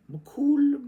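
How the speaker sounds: high loud call of McCool. Acted. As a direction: hail McCool across a hall. Cries out name in reading.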